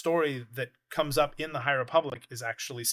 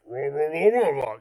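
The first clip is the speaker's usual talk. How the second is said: Jabba grunting; 2 semitones higher, 7 dB louder than usual. Retold or imitated. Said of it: "and Jabba's like".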